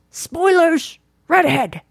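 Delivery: high, drawn-out alarm call; mock lookout's warning cry. Calls out movie-reference warning.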